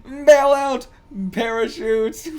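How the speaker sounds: high loud bail out; excited high yell; yelled command. Real, not acted. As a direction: shout it at the screen. Yelling at on-screen pilot.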